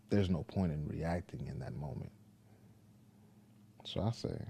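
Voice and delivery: normal talking voice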